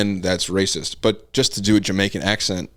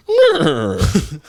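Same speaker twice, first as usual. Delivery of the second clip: loud high horse neigh; horse whinny; 11 semitones higher, 5 dB louder than usual. Shown as a demonstration. Podcaster demonstrating a sound effect, loud horse whinny. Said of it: "Like my horse".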